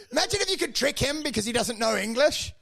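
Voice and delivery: ordinary talk, nothing marked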